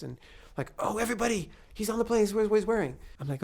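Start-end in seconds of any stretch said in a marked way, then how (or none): 0.8-3.0 high-pitched mock calling out; imitated excited paparazzo; excited announcing. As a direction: spread big news to the pack, pitched way up. Acted paparazzo alerting the others.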